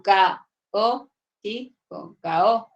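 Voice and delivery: slow syllable-by-syllable split; syllables pulled apart. Shown as a demonstration. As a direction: split "caótico" into single syllables. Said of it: "las tengo que separar".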